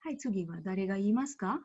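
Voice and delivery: usual speaking voice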